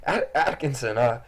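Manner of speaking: high, stammering startled man; startled dad. Acted. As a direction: stutter in confused surprise at the cat. GM voices startled dad to cat.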